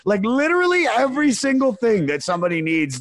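high and loud, piling it on in distress; rising panic. Retold or imitated. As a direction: list it all in a frantic rush. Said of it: "And she goes".

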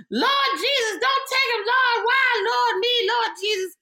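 very high, loud wailing to the Lord; hammed-up graveside grief, crying out in anguish; theatrical funeral mourner.

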